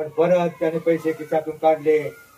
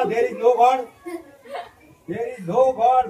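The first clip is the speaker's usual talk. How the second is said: laughing insistence; 5 semitones higher, 5 dB louder, much slower than usual. Acted. Professor laughing insisting no God.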